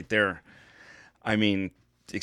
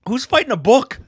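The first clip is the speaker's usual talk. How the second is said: high, loud disbelief; incredulous; 12 semitones higher, 5 dB louder than usual. Real, not acted. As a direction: sound baffled by the idea. Incredulous at matchup.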